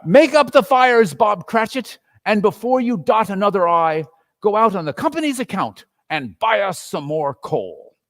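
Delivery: loud, high cheerful orders; jubilant Scrooge. A narrator's reading. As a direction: call out generously.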